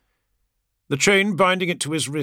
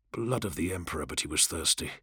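muttered curse; 8 semitones lower, 10 dB quieter than usual. A narrator's reading. Parched inner curse.

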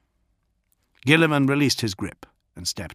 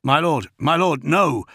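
raised, higher-pitched plea to stop; urgent cry; 5 semitones higher, 4 dB louder than usual. A narrator's reading. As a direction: cry out to restrain your master. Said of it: "Gorod cried".

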